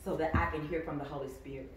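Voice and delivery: suddenly hushed, near whisper; inside voice. Real, not acted. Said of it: "So let me get in my inside voice".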